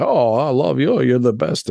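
lightly put-on Italian accent, fawning. Fans gushing over the player, light Italian accent. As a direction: gush like an Italian fan. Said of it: "going into an Italian accent for the Chinese people".

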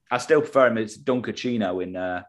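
normal talking voice

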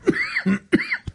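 high, sharp coughing fit; choking cough. Real, not acted. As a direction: cough after swallowing wrong. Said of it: "I swallowed the wrong way".

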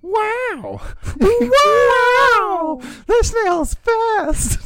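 very high, squeaky mock yell, slow at the end; cartoonish high ghost shouting about a snail; silly yelled ghost. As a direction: ham up the ghost as a goofy squealer. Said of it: "somebody was leaning over us and then yelled".

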